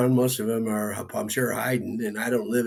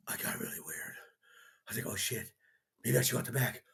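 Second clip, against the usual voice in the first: sudden hushed, much softer voice; 13 dB quieter, faster than usual. Retold or imitated. Scared narrator hiding, dropping voice.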